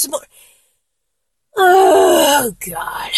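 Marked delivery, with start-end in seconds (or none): 1.6-3.1 long drawn-out, higher groan; crying out, overcome; overwhelmed cry. Acted. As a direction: break off into a helpless wail. Overwhelmed, breaks off mid-sentence.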